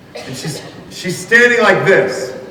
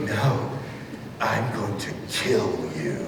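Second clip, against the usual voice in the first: very soft, low, slow insistence; whispered threat; 8 semitones lower, 12 dB quieter, slower than usual. Retold or imitated. Gym teacher correcting the performer's cheery reply, hushed low threat. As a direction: imitate a deadly serious murmured threat. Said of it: "he whispered, he leans over to me".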